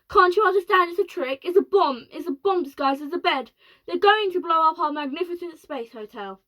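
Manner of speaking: alarmed president. Acted. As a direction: hammer home the danger. President panicking about bomb.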